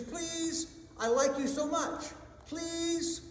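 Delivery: very high, wheedling lovesick plea; pleading. Acted. Suitor begging for a kiss.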